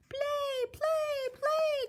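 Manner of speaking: very high eager chirps; excited baby goose. Acted. Gosling begs Jack, very high eager. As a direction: bounce it out eagerly. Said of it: "cried the excited baby goose".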